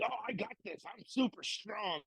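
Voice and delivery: soft high mock bravado; mock cocky newbie. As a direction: mimic a naive overconfident beginner. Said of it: "You think".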